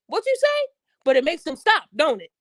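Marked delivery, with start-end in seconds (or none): 0.1-0.7 indignant woman. Retold or imitated. Woman's retort to being hushed.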